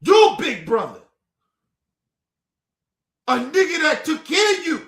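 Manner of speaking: high, heated, wounded protest; indignant shout. Real, not acted. Big brother protesting his sister's disrespect, shouting indignantly. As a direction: cry it out as an accusation.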